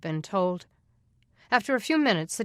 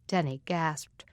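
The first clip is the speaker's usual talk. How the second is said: ordinary delivery, nothing marked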